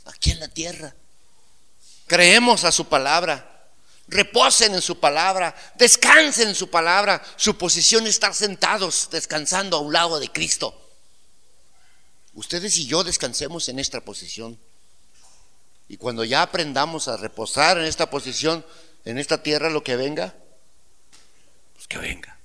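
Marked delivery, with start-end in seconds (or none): 2.1-10.8 higher, louder preaching; fervent sermon exhortation. Acted. Exhorting the congregation. 21.7-22.4 breathless whisper of defiance; whispered sermon aside. Acted.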